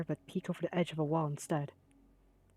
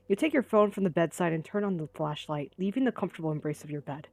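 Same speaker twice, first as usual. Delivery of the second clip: ordinary talk, nothing marked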